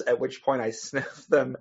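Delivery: ordinary talk, nothing marked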